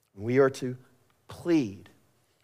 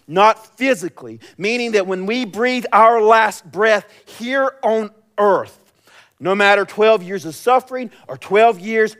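loud, high, building sermon cadence; full-force preaching; 9 semitones higher, 8 dB louder than usual. Acted. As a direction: proclaim God's promise with driving force.

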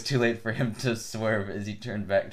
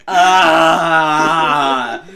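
long, very loud scream; drawn-out full-voiced "aah" yell; 5 semitones higher, 16 dB louder than usual. A narrator's reading. Turkey screaming after being yelled at in the ear, long and loud. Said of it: "Turkey yelled".

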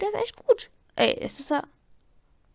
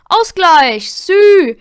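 excited shout; 6 semitones higher, 11 dB louder, much slower than usual. Real, not acted. Celebrating equalizer.

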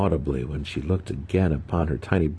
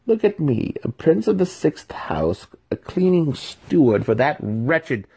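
much higher, indignant; bitter rant; 10 semitones higher, 3 dB louder than usual. A narrator's reading. Young apprentice rants about his servitude, bitter. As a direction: spit out the insult.

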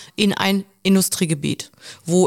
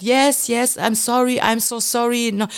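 higher, imitated placating apology; mock-meek, hurrying to agree; submissive; 3 semitones higher, slower than usual. Passenger apologizes to the lecturing armed man, retold submissive pleading. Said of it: "auch ein Stück weit devot".

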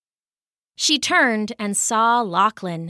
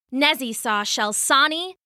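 plain reading voice

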